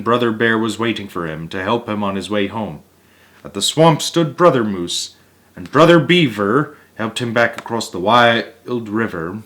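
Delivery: plain reading voice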